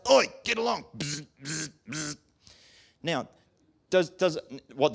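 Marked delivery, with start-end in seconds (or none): 0.1-0.8 high, rough herding call; cattle driver shout. Retold or imitated. Preacher acting out a farmer herding cattle. 1.0-2.2 prod buzz. Retold or imitated. Imitating the cattle prod.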